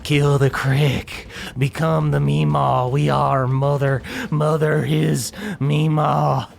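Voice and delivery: slow eerie mold mother; lightly voiced creepy monster; fungal creature voice. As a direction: chant it like a hive mind. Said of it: "fake Maribel speaks".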